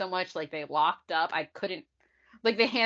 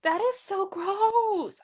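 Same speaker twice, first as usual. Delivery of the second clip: slow, high disgusted gag; retching through the words; dry heaving; 13 semitones higher, much slower than usual. Real, not acted. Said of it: "gagging and fake throwing up this whole episode".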